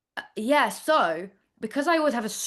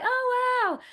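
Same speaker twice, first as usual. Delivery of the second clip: mock awe. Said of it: "they're like".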